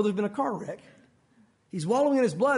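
ordinary talk, nothing marked

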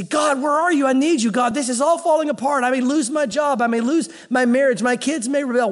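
worried pleading, one fear piled on another; panicked plea. Acted. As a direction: cry out to God in mock desperation. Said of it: "a little bit panicked".

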